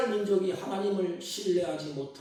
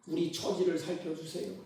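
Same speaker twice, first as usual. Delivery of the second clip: usual speaking voice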